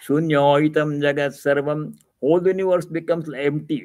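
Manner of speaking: normal talking voice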